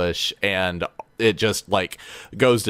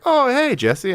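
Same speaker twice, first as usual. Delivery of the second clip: bright high hello, much higher; retold friend calling out a happy hello; cheery greeting; 4 dB louder than usual. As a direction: greet a buddy with big delight. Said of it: "he was like".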